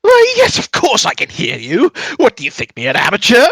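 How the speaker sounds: loud, high, theatrical wizard; swooping, high-pitched indignation; affronted mad mage. Acted. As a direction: take haughty offence as an eccentric mage. Game master voices affronted mage.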